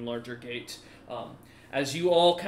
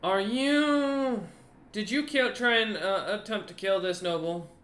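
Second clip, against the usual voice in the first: higher-pitched captain's interrogation; questioning guard captain; 6 semitones higher than usual. Acted. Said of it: "the captain looks over at you, Cinder, and goes".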